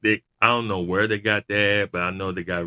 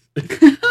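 real high laugh; 11 dB louder than usual. Real, not acted. Friend retelling a wrestling promo, laughing out loud.

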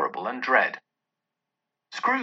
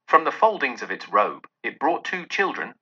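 plain reading voice

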